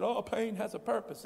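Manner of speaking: a high, singsong mock of a preacher; mocking preacher cliche. Mocking preachers who say it to hurting people, high singsong. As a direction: parody the pious cliche. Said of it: "I've heard preachers say it".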